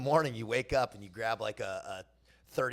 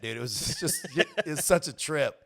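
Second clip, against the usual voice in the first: normal talking voice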